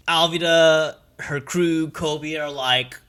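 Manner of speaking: ordinary delivery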